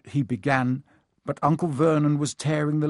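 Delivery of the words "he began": ordinary delivery, nothing marked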